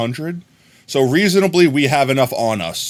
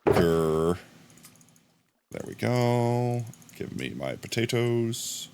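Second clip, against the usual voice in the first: put-on growly voice; 2 semitones lower, 9 dB quieter, slower than usual. Claiming the potatoes in a mild put-on growl. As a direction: play a grumpy creature guarding its food. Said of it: "I'll do their voice".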